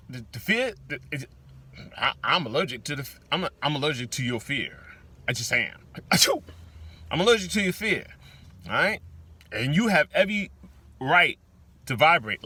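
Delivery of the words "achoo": mock sneeze. Allergic to your fear joke.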